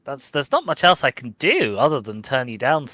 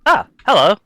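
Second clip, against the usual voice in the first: loud, high laughing hello; laughing greeting; 9 semitones higher, 7 dB louder than usual. Real, not acted. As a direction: burst out laughing as you greet. Lands on top of the friend.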